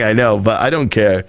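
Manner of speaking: ordinary talk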